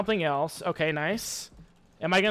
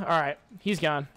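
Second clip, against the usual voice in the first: usual speaking voice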